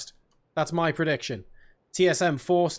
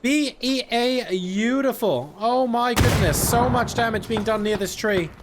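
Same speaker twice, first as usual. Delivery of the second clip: higher, drawn-out, relishing commentary; gleeful amazement; 4 semitones higher than usual. Real, not acted. Caster relishes the grenade damage near the tree, amazed. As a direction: savour each letter slowly, pitched up with delight.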